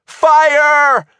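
high, loud, drawn-out alarm cry; scream. A narrator's reading. Shivers sees smoke billow out, screaming. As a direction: scream the warning in panic. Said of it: "Shivers screamed".

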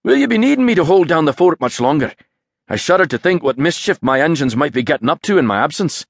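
hearty Aberdeen brogue; Aberdeen accent. A narrator's reading. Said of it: "His robust Aberdeen accent".

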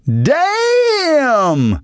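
very high, long called-out exclamation. A narrator's reading. Woman by her car calling out in awe. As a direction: hold the word out in awe. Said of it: "called out as well".

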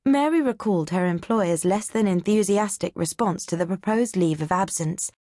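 ordinary delivery, nothing marked

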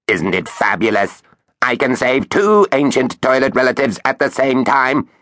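gushing robot voice; thrilled talking machine; excited robot. Acted. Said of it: "to show how excited it was".

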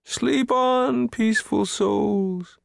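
high, stretched-out plaintive wail. A narrator's reading. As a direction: wail a sorrowful blessing. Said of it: "he wailed".

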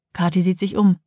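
usual speaking voice

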